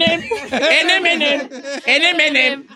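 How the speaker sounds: chuckles through the repeated phrase; cracking up at the fly in the candy; laughing. Real, not acted. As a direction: laugh while saying it over and over. Repeating the caller's funny phrase.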